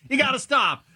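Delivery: high-pitched plea, played for laughs; mock desperate plea. Acting someone backing toward the cliff, mock desperation. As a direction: beg the talker to quit, hammed-up.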